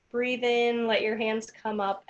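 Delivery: ordinary delivery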